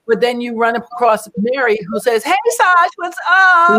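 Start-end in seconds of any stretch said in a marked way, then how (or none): 2.2-3.8 bright, high, loud hello; cheery greeting. Retold or imitated. Mary greets Sasha cheerfully, high and loud. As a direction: call out happily to a friend.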